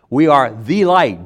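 "the" stressed, slow and loud; "We are the light" with weight on "the"; emphatic "the light". Shown as a demonstration. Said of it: "the emphatic emphasis of what we are".

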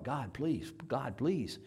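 hushed, repeated plea; crying out quietly. Retold or imitated. Ten-year-old praying in the storm, quiet plea. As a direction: beg softly under your breath. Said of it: "I was crying out quietly".

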